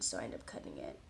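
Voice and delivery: quick whisper. Real, not acted. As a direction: rush it out in a whisper. Whispered aside on cutting hair.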